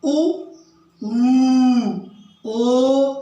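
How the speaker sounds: ऊ and ओ held long and loud, lips rounded; loud back vowel demo. Shown as a demonstration. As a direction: sing out ऊ, ओ for the class. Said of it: "जैसे".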